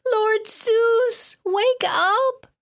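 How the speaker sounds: 高い叫び声で呼びかける; 必死に高く叫んで起こす; shriek. A narrator's reading. Shrieking for Zeus to wake.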